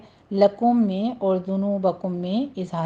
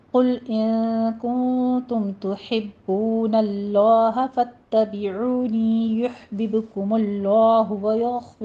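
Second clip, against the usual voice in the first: slow melodic tajweed chant; measured Quranic recitation; much slower than usual. Shown as a demonstration. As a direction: draw each word out like a reciter.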